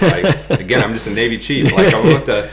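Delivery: high, loud, laughing self-deprecation; laughing protest. Real, not acted. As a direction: protest your cluelessness through laughter. Laughing over being out of place.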